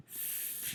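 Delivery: "fl" breathed softly; voiceless fl. Shown as a demonstration. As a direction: hiss out "fl". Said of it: "le L".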